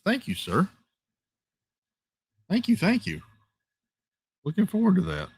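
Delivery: high, sing-song excited thank-yous; giddy, high-pitched gratitude; gushing thanks. Real, not acted. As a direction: gush your thanks way up high.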